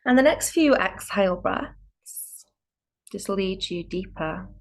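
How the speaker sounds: calm yoga guide with spacious pauses; guided meditation. Real, not acted. Yoga teacher preparing students for a still practice, calm guided meditation. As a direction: lead them inward, unhurried, phrase by phrase.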